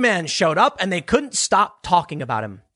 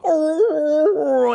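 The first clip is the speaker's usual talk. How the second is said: much higher, a drawn-out dying-creature moan; mock dying wail; 9 semitones higher than usual. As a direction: wail like a beast on its side. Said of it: "a dying creature laying on its side going".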